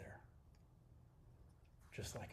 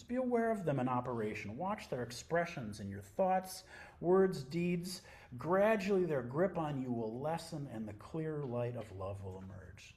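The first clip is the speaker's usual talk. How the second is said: louder, deliberate reading voice; reading scripture aloud; 5 semitones higher, 7 dB louder than usual. Acted. To the sangha, reading Nisargadatta Maharaj aloud, louder. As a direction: read it out like a text for the room.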